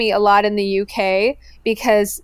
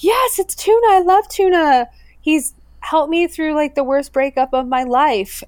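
high, gushing fan voice, pitched up; 7 semitones higher than usual. Retold or imitated. A girl on a train gushes about the dog, pitched up. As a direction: gush like a starstruck girl.

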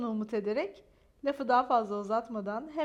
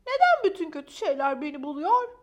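upset complaint; 3 semitones higher, 9 dB louder than usual. A narrator's reading. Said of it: "Üzgün Maya".

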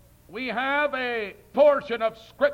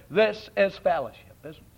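lower, smug mock voice of church liberals; a hammed-up sanctimonious clergyman, sinking lower; mocking ecumenists; 8 semitones lower, 4 dB quieter than usual. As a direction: sneer at them by mimicking their cosy talk. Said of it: "they all sit round together and they say".

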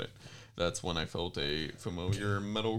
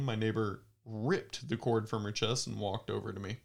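ordinary talk, nothing marked

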